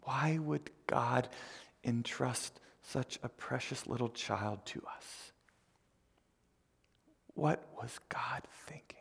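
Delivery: hushed, slow, near-whispered retelling of tears; understated tearfulness, sinking into whisper; imitated tearful questioning. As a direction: softly voice an overwhelmed new mother's plea. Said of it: "just in tears".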